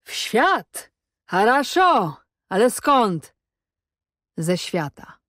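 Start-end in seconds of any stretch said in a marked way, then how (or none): 0.0-3.3 high, incredulous jeering repetition; mocking echo. A narrator's reading. Cossack echoes captive's answer, high mockery. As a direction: throw the answer back as a high question. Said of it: "zażartował".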